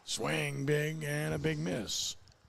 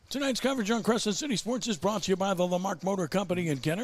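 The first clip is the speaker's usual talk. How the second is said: higher, polished announcer read; sponsor ad read; 7 semitones higher, 3 dB louder than usual. Real, not acted. Announcer thanks the broadcast sponsor for listeners, ad read. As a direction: sell the sponsor in an announcer's lift.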